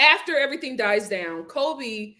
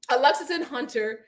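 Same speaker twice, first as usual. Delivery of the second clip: usual speaking voice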